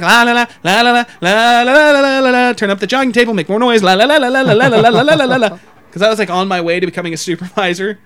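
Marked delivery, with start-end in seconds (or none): 0.0-2.5 raised, louder babbled la-la-la; sing-song nonsense to block someone out; drowning-out la-las. Retold or imitated. Supervisor-to-be avoiding an awkward question, loud la-las. 3.8-5.6 higher, running la-la babble; drowning-out la-las. Retold or imitated. Making more noise over coworker's question, evasive la-las.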